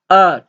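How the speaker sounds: R starting high and falling sharply; short falling Mandarin tone on R; fourth tone. Shown as a demonstration. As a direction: drop R steeply from a high start. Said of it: "fourth tone".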